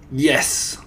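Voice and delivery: put-on firm, accented witch; commanding villainess yes; thundering. A narrator's reading. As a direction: confirm it with relish. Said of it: "thundered the Grand High Witch".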